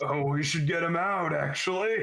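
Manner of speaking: put-on drunk sailor. Drunk sailors joking in GM narration.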